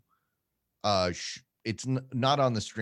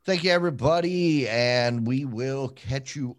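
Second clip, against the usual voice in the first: upbeat sign-off; 5 semitones higher, 10 dB louder, slower than usual. Real, not acted. Energetic stream sign-off.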